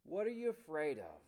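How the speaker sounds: ordinary talk, nothing marked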